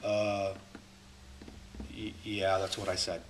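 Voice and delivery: low, quiet, halting admission; hesitate, then mumble it sheepishly; critic's answer. Acted. Critic answering the question posed back, low and hesitant. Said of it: "let's hear their answer".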